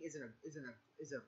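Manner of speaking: stumbling softly over the words; stuttering. Real, not acted. Draft presenter losing his words mid-praise, stuttering. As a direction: trip up and restart, voice dropping. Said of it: "Sorry for my stuttering of words".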